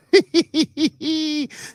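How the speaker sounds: high-pitched giggling cackle; laughing. Real, not acted. Host cracking up at a viewer's comment, high laugh.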